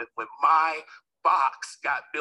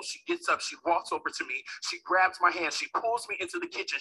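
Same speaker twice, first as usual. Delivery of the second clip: usual speaking voice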